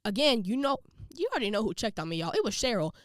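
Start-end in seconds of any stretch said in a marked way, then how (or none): none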